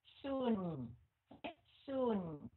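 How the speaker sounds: "Soon" falling into a nasal N; nasal N ending. Shown as a demonstration. Teacher showing the N sound, nasal-ending "Soon". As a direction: let each "Soon" sink into the nose on N. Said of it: "Giọng mũi là âm N".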